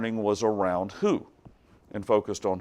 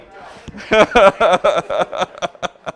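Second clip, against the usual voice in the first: long, loud cackling laugh; high, loud laughter; hearty laugh; 8 semitones higher, 9 dB louder than usual. Real, not acted. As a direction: burst out laughing at your own joke. Laughs at own rant.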